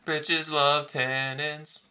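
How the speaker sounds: mock sultry echo. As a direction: stretch it out, teasing. Echoing the catchphrase back.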